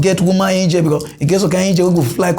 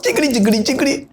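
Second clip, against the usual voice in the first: higher-pitched quick babble, lightly mocking; mimicked chattering critics; 6 semitones higher, much faster than usual. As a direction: mimic people yapping in gibberish. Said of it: "A lot of people call dey talk".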